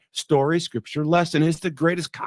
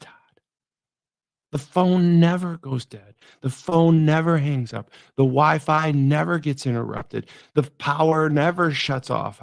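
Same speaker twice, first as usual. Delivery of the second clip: ordinary talk, nothing marked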